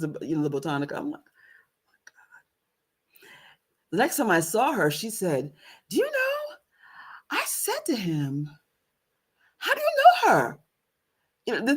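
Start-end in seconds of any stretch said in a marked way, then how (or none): 1.2-2.4 breathless whispered gasp; shocked whisper. Retold or imitated. 5.9-10.6 high retold question; imitating the godmother. Godmother quoted.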